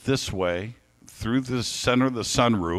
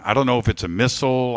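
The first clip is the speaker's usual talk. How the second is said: normal talking voice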